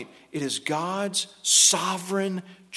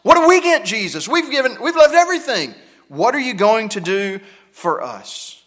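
raised, louder pleading question as Peter; Peter's plea; 4 semitones higher, 6 dB louder than usual. Retold or imitated. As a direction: demand it like a disciple who gave up everything. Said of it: "It's the same question Peter asked".

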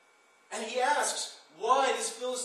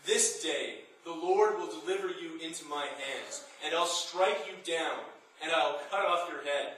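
louder, declaiming battle cry; preaching at full force; 4 dB louder than usual. Acted. Preacher thundering David's challenge to Goliath. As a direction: proclaim it like a sermon's climax.

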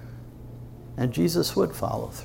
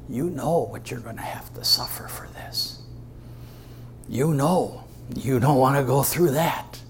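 tempter's whisper; 3 semitones higher than usual. Acted. Satan whispering into Jesus' ear.

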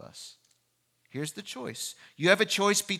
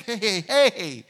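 loud, higher mock protest; acted alarmed interruption; calling out; 4 semitones higher, 8 dB louder than usual. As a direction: flag someone down urgently. Flagging down his defense attorney mid-trial.

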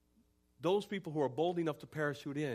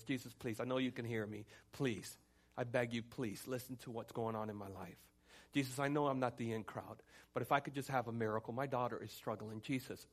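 soft, lowered begging; persistent pleading; 3 semitones lower, 5 dB quieter than usual. Acted. Desperate mother begging Jesus for her daughter, soft persistent plea. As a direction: keep pleading quietly, one appeal after another. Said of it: "she continued to say".

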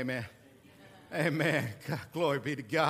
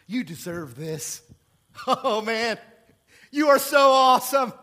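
laughing, gushing, rising to a shout; Satan flattering us, gushing loud; 6 semitones higher, 11 dB louder than usual. Acted. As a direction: hype him up like a fawning tempter. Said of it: "Satan does the same thing to us".

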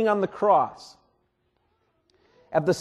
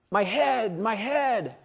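a higher, mock cry of pain that fades on the repeat; 5 semitones higher, slower than usual. Pastor imagining Jesus crying about his head, pained. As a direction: moan over a wounded head. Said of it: "Jesus didn't cry out".

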